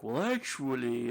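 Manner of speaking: drawn-out, slow mocking "well, actually"; swooping pitch, slow mock correction; know-it-all interjection. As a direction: imitate a pedantic corrector, stretching it out. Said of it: "and somebody's like".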